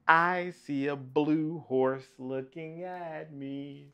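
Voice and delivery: drawn-out, lilting answer line; half-sing the line to little listeners; sing-song story reading. Acted. Reader answering the book's question for children, sing-song.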